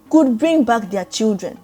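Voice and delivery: usual speaking voice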